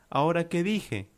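confused. Acted. Juan, called liar and show-off by Lucía, lightly confused. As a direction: ask it high, as if puzzled.

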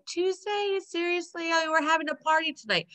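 high-pitched, mock-incredulous protest; incredulous complaint. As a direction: imitate someone put out by bad news. Retelling Rachel's dismay at the delay.

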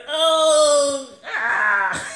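high yelping cry of a wrestler taking a hit; hammed-up cry of pain; mock pained cry. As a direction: yelp like you just got slammed. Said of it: "And you'd be like".

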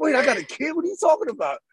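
as if hearing you're a father; shocked disbelief. Retold or imitated. Retelling his own shock to the host, high disbelief. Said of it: "I was like".

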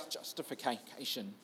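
ordinary delivery

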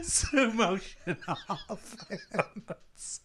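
laughing. Real, not acted. Repeats nickname laughing.

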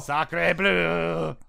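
mock French exclamation; French accent. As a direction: toss it off in a hammy French accent. Joking about the French.

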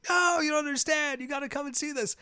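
very high, strained mock yell; mock screaming. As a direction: reenact screaming back, voice way up high. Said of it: "screaming at the top of my lungs".

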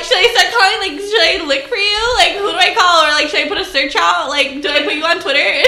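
high, loud panicky rambling through laughter; hammed-up worried girlfriend; mock frantic worry. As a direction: play up the spiralling panic for laughs. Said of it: "now I'm kinda worried".

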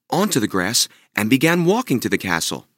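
usual speaking voice

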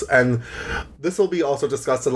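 usual speaking voice

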